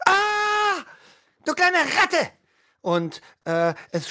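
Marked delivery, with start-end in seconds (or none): 0.1-2.3 hoch, laut angeschrien; wütender Schrei. Acted. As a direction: schrei den Dieb wütend an. Wache schreit den Jungen an. (Wache brüllt den Jungen an)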